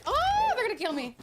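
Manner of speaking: high wail of alarm, then falling; high-pitched gamer panic; alarmed yelp. Real, not acted. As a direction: yelp in panic as enemies close in. Under attack in the game.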